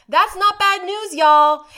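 "not" hit loud and high, y'all shouted out; loud redo. Shown as a demonstration. Correcting "guys" to y'all, loud and high. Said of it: "Sorry I said guys".

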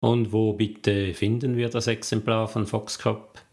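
ordinary delivery

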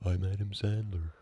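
quiet, laid-back mock star voice; Adam Sandler. Podcaster mimics the actor introducing himself, lightly mumbly impression. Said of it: "He talks like this".

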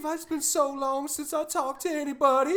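put-on high, loud, pleading old lady voice; imitating a weepy old woman begging; mock crying old lady. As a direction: plead like a lonely crying grandma. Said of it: "that old lady, like, crying on the phone".

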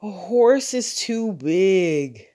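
drawn-out yawning father. A narrator's reading. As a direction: say it through a big yawn. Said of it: "Father yawned".

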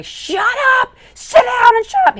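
high, loud shouted orders; retold shout. Cousin Raymond, stressed at the wheel, shouting at everyone on the boat. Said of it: "telling everybody".

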